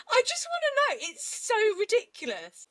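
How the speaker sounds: very high, squeaky near-crying laughter; helpless disbelief. Real, not acted. Co-host overcome by the ridiculous law, helplessly incredulous.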